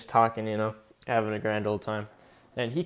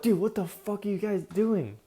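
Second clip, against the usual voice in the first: alarmed disbelief; 8 semitones higher than usual. Retold or imitated. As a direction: imitate a freaked-out bystander, pitched way up. Retold protest after jumping downhill.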